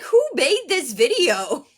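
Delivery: high, loud, drawn-out disbelief; incredulous outburst, stretching the words; mock-outraged reaction; retold indignation.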